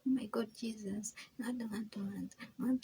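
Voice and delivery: whispering a hammed-up pious prayer to God; mock pious whisper. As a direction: parody a showy prayer in a hushed whisper. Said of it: "pretending that".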